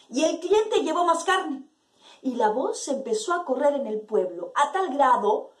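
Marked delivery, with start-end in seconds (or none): none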